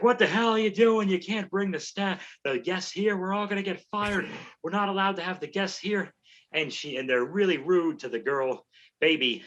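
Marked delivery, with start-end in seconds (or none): 0.0-6.1 high, mock-panicked scolding voice; a nervous coworker hissing a warning, pitched up; angry staff guy. Coworker scolds Baby for bringing guests, high panicked voice. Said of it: "and he's like".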